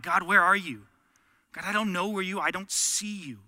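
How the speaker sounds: loud desperate cry upward; crying out to God. Retold or imitated. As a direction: call out to God as if He's gone silent. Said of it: "you're screaming out".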